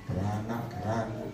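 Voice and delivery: soft, low, half-hearted; wavering, doubtful. Retold or imitated. Believer who doubts once outside, soft and wavering. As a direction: say it weakly, without conviction. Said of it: "Ternyata di luar bimbang".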